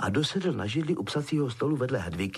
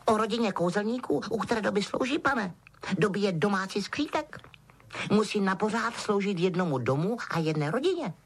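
high, deferential elf voice; squeaky little servant reciting his duty; Dobby, dutiful house-elf; 7 semitones higher than usual. A narrator's reading. Elf explaining whom he serves, dutiful.

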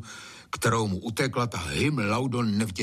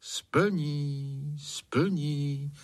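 drawn-out, higher echoing repeats; cheerful echo; 6 semitones higher than usual. A narrator's reading. Echo repeats the spirit's promise, cheerful and drawn out. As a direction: sing it back like a mountain echo. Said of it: "opakovala vesele ozvěna".

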